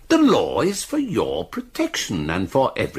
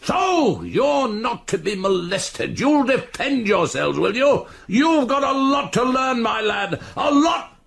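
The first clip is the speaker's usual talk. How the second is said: high, shouted, mocking fury; enraged taunting; 9 semitones higher than usual. Chief constable threatening the defiant boy, enraged. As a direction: throw the boy's words back at full pitch.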